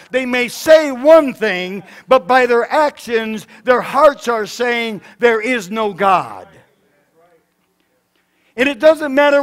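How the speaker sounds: loud, insistent preacher building to a shout; full-force preaching. Real, not acted. Preacher answering professors and politicians with God's word, full force.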